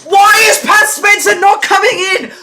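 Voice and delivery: real fan outrage; angry shout. Real, not acted. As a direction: shout your disbelief at the bench. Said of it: "I know I sound angry".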